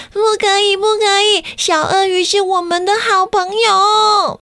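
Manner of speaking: put-on high pleading child voice; child's voice. A narrator's reading. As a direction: beg like a small child. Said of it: "有人跟大人说".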